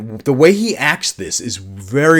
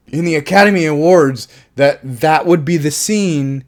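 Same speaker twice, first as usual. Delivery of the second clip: usual speaking voice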